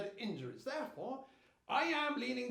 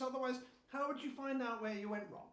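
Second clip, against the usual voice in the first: usual speaking voice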